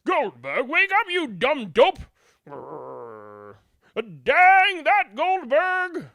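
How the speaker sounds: high loud grumpy shout with a growl; village yelling. Acted. Villagers shout at Goldberg, high loud grumpy yell. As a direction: curse him out, slow at the end. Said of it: "they would all yell too".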